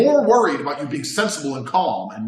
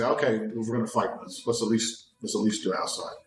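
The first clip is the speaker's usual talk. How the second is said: calm quiet resolve; 5 semitones lower, 7 dB quieter than usual. Retold or imitated. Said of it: "that sudden deceleration".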